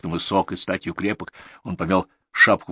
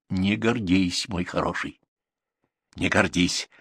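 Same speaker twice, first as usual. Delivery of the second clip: checking him; slower than usual. A narrator's reading. Manager stops Viktor's boasting, gently checking him. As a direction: rein him in without raising the voice. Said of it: "остановил его управ".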